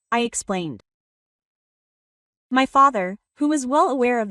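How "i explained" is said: usual speaking voice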